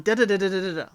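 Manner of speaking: rattled-off "da da da" imitating a nag; mock nagging chatter. Recapper mimicking K B pushing it, mock chatter. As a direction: imitate someone going on and on. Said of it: "He's, like, quiet. She's the one".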